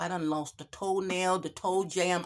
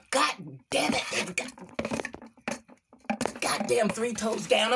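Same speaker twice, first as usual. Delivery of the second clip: shocked gasp; 6 semitones higher than usual. Acted. Third toe drops, shocked.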